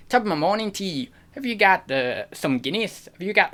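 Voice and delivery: high, quick Irish greeting that wavers. Shown as a demonstration. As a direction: put on a stock Irish accent. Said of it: "doing all the stereotypes".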